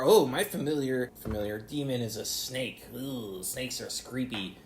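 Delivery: hammed-up sinister hush; a hint of a creepy cultist; creepy villain voice. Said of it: "they talk in a really creepy voice".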